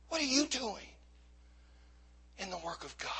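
very soft, falling almost to a whisper; hushed challenge. Real, not acted. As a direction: let the question sink in quietly, pausing midway. Same question repeated hushed to congregation.

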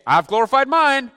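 loud, high mock interruption; hammed-up heavenly announcement; interjecting angel. Acts angel butting in.

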